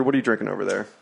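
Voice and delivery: usual speaking voice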